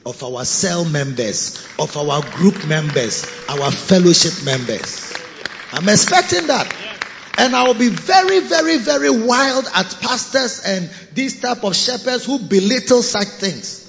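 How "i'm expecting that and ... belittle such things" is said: raised voice, higher and louder; fiery preacher pitch; preaching loud. Acted. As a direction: drive it home from the pulpit. Preacher rising to full force.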